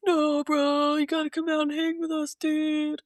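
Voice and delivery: high, fast pleading bro voice; frat bro. Retold or imitated. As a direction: beg your buddy like a frat guy. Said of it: "They're like".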